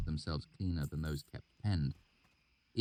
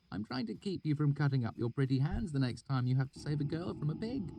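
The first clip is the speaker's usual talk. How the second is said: put-on higher, teasing young woman; playful scolding in a higher voice; Denner's voice; 10 semitones higher than usual. A narrator's reading. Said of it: "She cocked her head".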